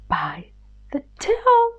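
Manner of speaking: ordinary delivery, nothing marked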